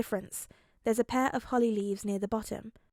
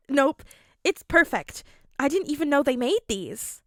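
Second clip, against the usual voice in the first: delighted; 4 semitones higher, 6 dB louder than usual. Acted. Mirio delighted.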